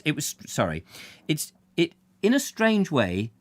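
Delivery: normal talking voice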